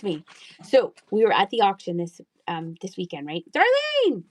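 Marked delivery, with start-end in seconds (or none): 3.5-4.2 Darlene called out loud and high; calling out loud. Real, not acted. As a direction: call her name across the room, much higher. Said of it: "Sounds like I'm yelling at you".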